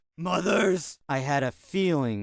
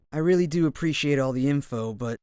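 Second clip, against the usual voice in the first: usual speaking voice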